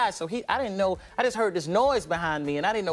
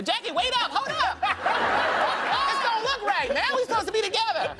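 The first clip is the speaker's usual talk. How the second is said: high pleading call after Jackie; whiny mock plea; 14 semitones higher than usual. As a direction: beg him to wait up, voice up high. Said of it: "chasing Jackie".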